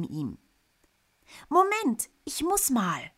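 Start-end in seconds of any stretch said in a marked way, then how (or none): 1.5-3.1 high, loud call; calling over. Acted. Boy calls to flying uncle, high and loud. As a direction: shout it across the air. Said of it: "ruft Fred zu Onkel Hans hinüber".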